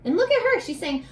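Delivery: plain reading voice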